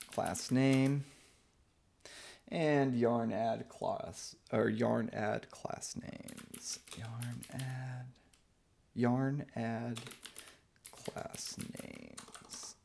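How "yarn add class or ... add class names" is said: muttering to self. Real, not acted. Muttering while typing command.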